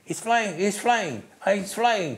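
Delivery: high, urgent repeated cry; excited radio shout. Retold or imitated. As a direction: blurt it out like a stunned spotter on the radio. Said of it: "Frank was on the radio and said".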